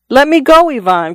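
put-on boy pinned by a drunk giant, crying out. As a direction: cry out to break free of the grip. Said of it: "cried Simon".

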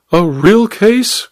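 high, slow disbelieving cry; incredulous cry. A narrator's reading. Duchess appalled at Nigel, incredulous cry. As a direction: echo his words in shocked disbelief. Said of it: "cried the Duchess".

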